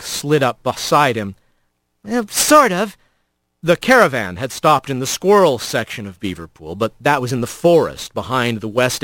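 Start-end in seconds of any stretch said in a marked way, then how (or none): 2.1-3.0 hesitant rat. Acted.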